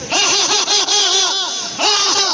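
high, loud, hearty ha-ha laughter; loud demo laugh. Shown as a demonstration. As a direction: lead the group laughing at full volume. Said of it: "నా ఊరికే నవ్వండి sir". (బిగ్గరగా నవ్వు)

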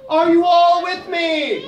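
slow, loud, called down the slope; calling down the mountain. Acted. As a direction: shout down to the animals. Calling down scared.

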